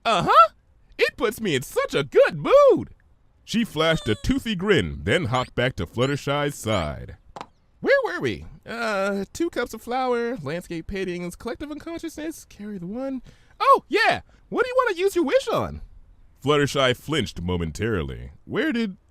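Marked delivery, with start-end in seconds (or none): 0.0-2.9 very high, giddy sing-song; bubbly Pinkie voice. Acted. Pinkie playing with words at the market, high and giddy. 7.8-15.8 very high, giddy sing-song; bubbly Pinkie voice. Acted. Pinkie playing with words at the market, high and giddy.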